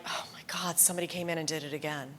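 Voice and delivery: hushed, fast whispered dismay. Retold or imitated. Bookseller finding the books tampered with again, whispering in dismay. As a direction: breathe it out in a quick whisper, aghast. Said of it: "you're like".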